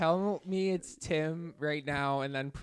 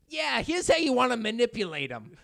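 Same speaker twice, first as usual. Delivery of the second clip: sleazy salesman; 7 semitones higher, 3 dB louder than usual. Retold or imitated. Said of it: "sales is so fucked how it's just like".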